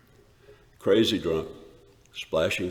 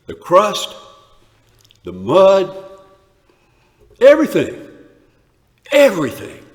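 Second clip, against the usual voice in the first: much higher and louder, rising to a rapturous cry; ecstatic outburst; 10 semitones higher, 11 dB louder than usual. Acted. Shepherd reliving that night in the field, ecstatic cries. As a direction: let the joy burst out word by word.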